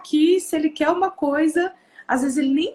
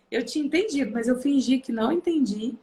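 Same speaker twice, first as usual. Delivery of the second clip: normal talking voice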